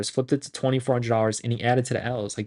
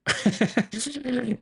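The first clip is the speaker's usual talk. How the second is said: high laugh; 11 semitones higher, 3 dB quieter than usual. Real, not acted. Laughs at his own joke.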